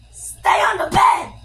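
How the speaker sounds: sudden loud yell to a pet; shout. Real, not acted. Kid yelling at the dog to stay on the bed. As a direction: yell it out sharply, high and loud. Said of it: "Sorry for screaming".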